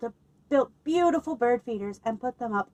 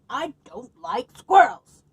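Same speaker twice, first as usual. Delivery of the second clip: shouted; 4 semitones higher, 7 dB louder, slower than usual. Acted. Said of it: "shouted the old man".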